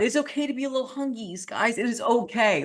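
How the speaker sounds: high, sing-song coddling voice; mock-sweet reassurance to whiny dieters; mock baby talk. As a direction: coo at them like toddlers.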